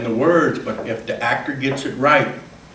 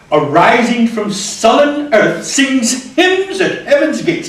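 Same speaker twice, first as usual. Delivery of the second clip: higher, louder, slow and lifting; soaring climax; 9 semitones higher, 7 dB louder, slower than usual. Shown as a demonstration. Actor reciting a sonnet, soaring climax. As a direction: let the lark soar upward.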